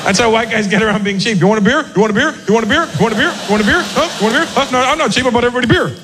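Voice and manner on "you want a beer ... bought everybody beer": insistent, repeated offer; pushy beer buddy. Acted. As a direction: keep pushing the beer on everyone.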